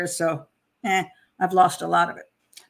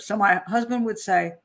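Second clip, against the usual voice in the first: ordinary delivery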